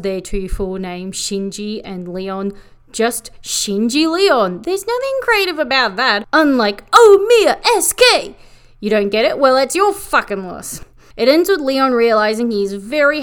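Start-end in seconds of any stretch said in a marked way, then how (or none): 4.6-8.3 high-pitched, rising comic outburst; mock indignation. Real, not acted. Reviewer comparing idol group names, mock indignant.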